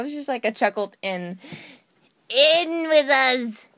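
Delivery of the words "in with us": slow, loud, higher chant; booming. Acted. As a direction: call them in slowly. Villain line in voice acting.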